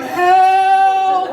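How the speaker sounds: high, loud comic cry for help; brain yelling help. Retold or imitated. Your brain on nitrous crying out, high yelp. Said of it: "when it's going".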